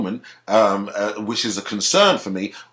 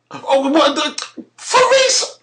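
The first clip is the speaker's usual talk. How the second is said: much higher, louder, half-laughing squeak of disbelief; stunned disbelief; 4 dB louder, slower than usual. Real, not acted. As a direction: scoff through a laugh, voice shooting up. Mocking the research excuse.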